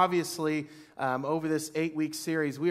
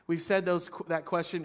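ordinary talk, nothing marked

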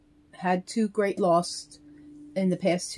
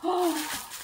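surprised gasp; 10 semitones higher, 5 dB quieter than usual. Real, not acted. Sees the matching hat.

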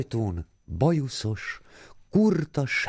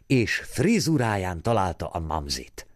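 plain reading voice

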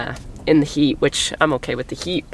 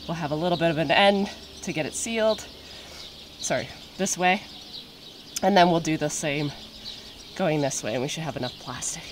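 ordinary talk, nothing marked

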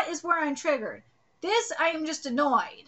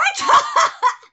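a loud, high burst of laughter; loud gleeful laugh; high triumphant laugh; 11 dB louder than usual. Acted. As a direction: cackle with glee at the score. Voicing a character's triumphant laugh.

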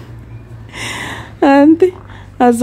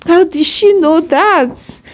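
real surprise, pitch shooting up; surprised; 10 semitones higher than usual. Real, not acted. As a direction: wonder aloud in high-pitched amazement. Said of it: "that actually surprised".

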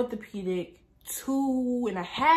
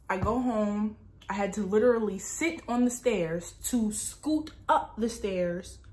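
ordinary talk, nothing marked

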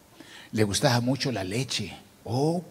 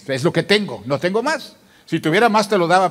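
insistent haggling; 6 semitones higher, 8 dB louder than usual. Retold or imitated. Retold haggling over church price.

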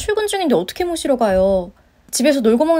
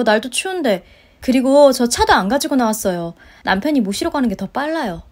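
ordinary talk

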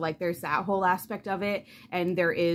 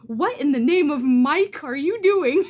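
high, loud theatrical outrage; mock old-fashioned exclamation; 8 semitones higher, 9 dB louder than usual. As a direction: ham up a prim scandalised character. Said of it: "somebody said".

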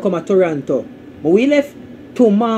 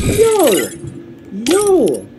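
high call-out; 7 semitones higher than usual. Real, not acted. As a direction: shout it up to get chat's attention. Calling out to chat.

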